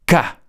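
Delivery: "K" spat out as a sharp, loud click; hard K. Shown as a demonstration. Teacher demonstrating to students a clear consonant attack on "K". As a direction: hit "K" with a strong burst. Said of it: "si yo digo".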